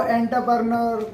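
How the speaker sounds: एंटरप्रेन्योर starting "en", run together as enter-pre-ner; common reading. Shown as a demonstration. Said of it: "बहुत सारे लोग बोलते हैं".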